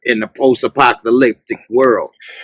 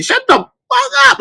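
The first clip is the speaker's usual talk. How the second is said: much higher, exasperated shout; yelling at the screen. Retold or imitated. Telling show characters off from the couch, an exasperated high shout. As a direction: snap it at the characters like they're right there. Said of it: "telling somebody".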